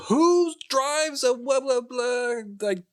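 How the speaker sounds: high, drawn-out mock yell; stretched, high-pitched holler; retold yelling. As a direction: call out slowly across a parking lot. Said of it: "I just start yelling".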